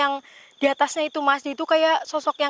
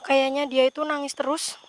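normal talking voice

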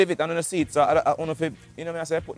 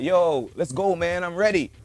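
raised American-style twang; imitated foreign twang; 5 semitones higher, 4 dB louder, slower than usual. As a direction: repeat it in a foreigner's accent. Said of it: "speak like them".

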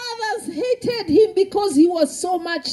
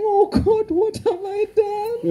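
high, pleading cry; crying to God; 4 semitones higher, much faster than usual. Acted. Boy in the dark pit cries out to God, high pleading. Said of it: "I can imagine him crying".

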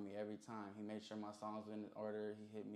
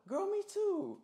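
mock girly voice, far higher and louder; imitating a girl; 7 dB louder, slower than usual. A rapper mimics a girl answering another girl, high mock girly squeal. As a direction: squeal it like an excited party girl. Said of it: "She goes".

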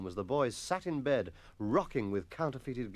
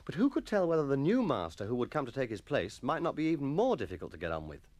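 ordinary delivery

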